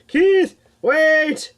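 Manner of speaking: high, loud calling after him; calling out to Keith flying off; shouting. A narrator's reading. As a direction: yell it across the distance. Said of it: "Jay shouts".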